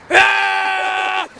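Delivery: sudden high yelp; loud shriek. Retold or imitated. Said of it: "that one too".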